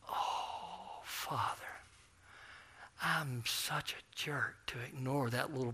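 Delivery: hushed whispered self-rebuke; whispered prayer. Retold or imitated. Preacher whispers to God after ignoring the boy, hushed. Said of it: "And I went".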